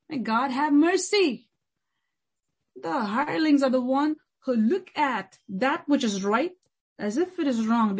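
ordinary delivery

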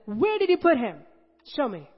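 high and loud command; Jesus insisting at the tomb, loud and firm; commanding demand. Acted. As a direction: demand it with authority.

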